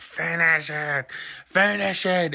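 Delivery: high, drawn-out villain command; hammed-up order, climbing on the repeat; Green Goblin. Villain interrupting an old lady's prayer, high and menacing. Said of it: "the Goblin's like".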